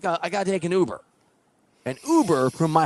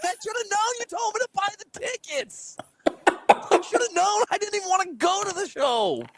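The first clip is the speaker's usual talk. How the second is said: high-pitched, drawn-out indignant whine; exasperated mock protest. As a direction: protest in a squeaky, wronged voice. Acted quarrel with Lori at home.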